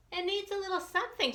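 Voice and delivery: quick high mother's voice; lightly voiced mom tasting soup; mommy tasting. A narrator's reading. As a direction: voice mom musing over the pot. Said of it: "she says".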